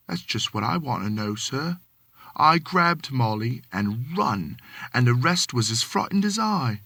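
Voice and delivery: lightly voiced Cockney manservant, deferential; a hint of London Cockney, polite to his master; Cockney servant. Thomas answering his employer, light Cockney.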